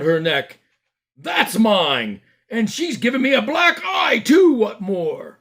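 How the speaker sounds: high, loud indignant shouting; outraged shopkeeper. Acted. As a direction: demand justice at full volume. Fat man demands arrest.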